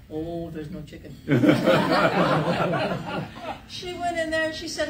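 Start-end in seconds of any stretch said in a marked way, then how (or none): 0.1-3.3 laughing. Retold or imitated. George feigning innocence.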